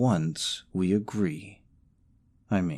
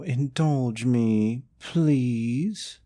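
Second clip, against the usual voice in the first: slow, high, drawn-out begging; higher, lingering plea to a sweetheart; coaxing plea; 4 semitones higher, much slower than usual. Acted. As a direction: wheedle it, stretching the words. Begs sweetheart after rival objects.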